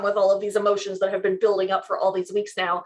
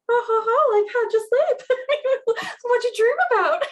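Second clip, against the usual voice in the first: high, over-bright mock nonchalance; forced laugh into a high innocent question; fake casual; 13 semitones higher than usual. Woman probing her boyfriend about his dream, fake casual. Acting casual the morning after.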